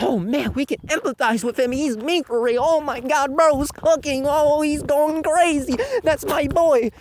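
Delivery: high-pitched gushing fanboy impression; mock excited fans. Fans cheering their secret underdog, mock-thrilled. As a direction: build into hammed-up hype.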